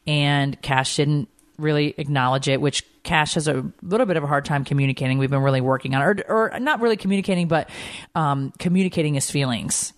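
ordinary talk, nothing marked

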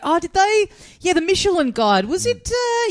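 mock pontificating.

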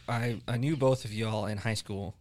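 normal talking voice

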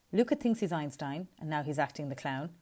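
ordinary delivery

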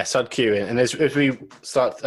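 usual speaking voice

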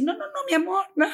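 high coaxing "no, no, no, mi amor"; soothing a child. Retold or imitated. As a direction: gently stop the toddler, voice lifted high. Stopping child with stone.

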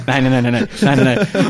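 loud, rapid panicked no's. Retold or imitated. To co-hosts, reliving the moment the car died, loud and panicked. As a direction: blurt out no over and over in alarm.

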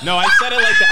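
loud, laughing protest; laughing shout. Real, not acted. Defending joke, laughing loudly.

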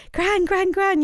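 high, eager calling out; excited calling. Retold or imitated. Granddaughter running in calling gran with news, excited. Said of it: "so excited, running in".

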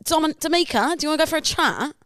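high-pitched mock Essex accent; Love Island accent. Love Island contestant inviting for a chat, Essex accent. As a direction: coax in a put-on high Essex voice. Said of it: "what they do on Love Island".

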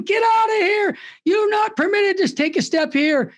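retold shriek of an angry booth coordinator; mimicked scream. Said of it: "She started screaming".

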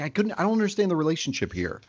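high, fast, bewildered rush of words; confused. Retold or imitated. Cohost agreeing the scene confused him, quick baffled. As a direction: hurry through the confusion. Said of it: "Yeah, I was like".

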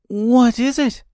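put-on high, curious girl voice; surprised. A narrator's reading. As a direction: ask in surprise as a young girl. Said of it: "answered Dorothy surprisingly".

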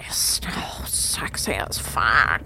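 breathy swooning whisper. Acted. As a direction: whisper it slow and dreamy. Swooning over Zig.

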